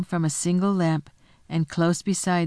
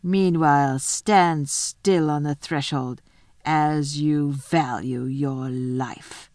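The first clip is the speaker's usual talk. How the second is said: slow wolf's warning; low, drawn-out menace from a wolf; 3 semitones lower, slower than usual. Acted. The wolf warns Edmund at the threshold, slow menacing wolf voice. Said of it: "said the wolf".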